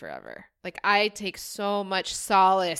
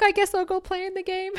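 amused self-quote; 12 semitones higher than usual. Retold or imitated. Quoting her own reply, laughing.

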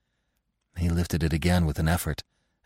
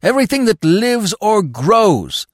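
high, fervent proclamation; exultant devotion, swelling high; quivering exultation; 7 semitones higher, 4 dB louder than usual. A narrator's reading. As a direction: proclaim it with worshipful fervour. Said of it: "The dog was quivering with exultation".

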